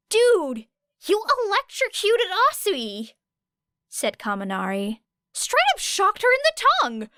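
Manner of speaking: put-on high, clueless young guy asking; Kaminari's voice. A narrator's reading.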